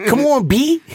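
high, loud put-on voice; mocking imitation. Teasing his friend over how he sounds, mocking mimicry.

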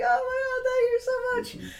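high, loud and choked up with relief; a retold sob of relief, pitched high; tearful thanks. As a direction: gush thanks through happy tears. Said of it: "I'm just bawling my eyes out".

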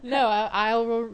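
real laugh breaking into the words; big real laugh at being praised. Real, not acted. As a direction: laugh loudly, then try to talk. Laughing after compliment.

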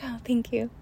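soft relieved whisper; whispered thanks. Retold or imitated. Thanking the conductor who opened the door, in a whisper. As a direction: breathe the thanks out.

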